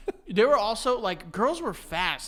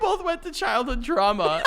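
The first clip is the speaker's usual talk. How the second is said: ordinary talk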